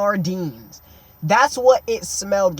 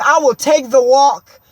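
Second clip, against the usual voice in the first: loud, slow, every word drawn out; hammed-up fed-up speech, stretching the words; exasperated declaration; 3 semitones higher, 5 dB louder, much slower than usual. Kid telling driver he will walk, exasperated. Dramatic refusal to stay on.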